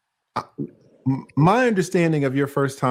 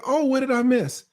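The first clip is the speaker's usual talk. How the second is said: much higher, eager innocent voice; mock-polite self quote; 12 semitones higher than usual. Host imitating his own reply to a caller, much higher and eager. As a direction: play yourself as all innocence, pitched way up. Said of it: "I'd be like".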